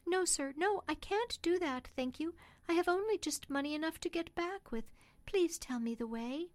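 high-pitched, humble woman's voice; politely refuse, then plead; poor mother declining. Acted. Mother turning down a cab she cannot afford, high and pleading.

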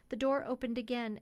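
plain reading voice

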